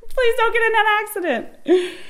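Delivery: high, loud mock plea; yelling. Woman in labor to husband going ninety, yelled plea. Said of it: "I'm yelling at Sean now".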